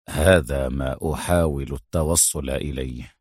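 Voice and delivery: very low, thoughtful hero; pondering. A narrator's reading. Adham pondering the mystery for Mona, low and thoughtful. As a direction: think aloud, pitch dropped right down. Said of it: "وقال وهو يمسك بذقنه".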